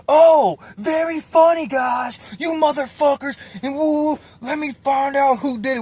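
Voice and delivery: high, loud mock-furious shouting; imitated mad rant. Furious cellmate accusing the pranksters, high angry shout. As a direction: call out the pranksters like an enraged cellmate. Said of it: "He's mad".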